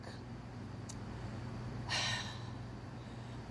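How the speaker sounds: long, soft exhale; long sigh. Real, not acted. Storyteller on spotting boyfriend's truck, long soft sigh. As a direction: let all the air out slowly.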